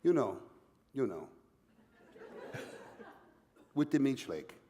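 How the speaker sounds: mock French accent with long pauses; bad French accent. French Quebecer answering the puzzled band, halting mock French accent. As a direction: spell out the grievance in a put-on French accent. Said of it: "bad attempt at a French accent".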